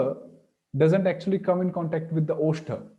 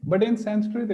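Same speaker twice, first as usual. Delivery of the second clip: normal talking voice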